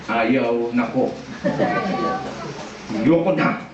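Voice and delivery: very low, drawn-out imitation of a woman in labour; mock labour agony. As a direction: drag it out low, acting worn out by childbirth. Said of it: "maghihirap ka". (kunwaring hirap sa panganganak, mababa at mabagal)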